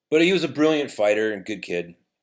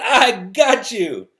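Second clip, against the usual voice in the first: gloating out loud; 11 semitones higher, 7 dB louder, much slower than usual. Retold or imitated. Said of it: "And he said out loud".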